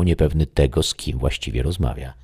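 ordinary delivery

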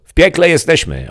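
high, loud shout from up a ladder; shouting back. A narrator's reading. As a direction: yell it down grumpily. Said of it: "odkrzyknęła postać z góry". (barman odkrzykuje z góry, głośno i wysoko)

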